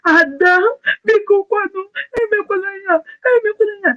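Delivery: high pleading wail in Igbo; mock sobbing plea, pitched high; imitating a crying plea. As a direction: beg in a high, tearful voice, mimicking the caller. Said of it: "She was crying bitterly".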